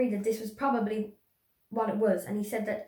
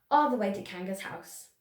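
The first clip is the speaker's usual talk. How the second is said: usual speaking voice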